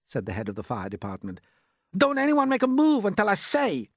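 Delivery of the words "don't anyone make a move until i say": high, loud command; urgent order. A narrator's reading. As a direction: call a warning to freeze. Alarmed fire chief gives order.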